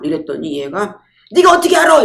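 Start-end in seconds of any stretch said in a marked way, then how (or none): 1.3-2.0 loud, high-pitched imitated outburst; indignant retort. Flirting woman challenged by the speaker, indignant retort. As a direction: fire back in mock indignation, voice jumping up. Acquaintance's retort in retold quarrel.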